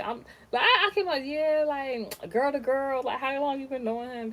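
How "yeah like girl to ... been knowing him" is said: overly polite. Retold or imitated. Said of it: "I was very polite about it".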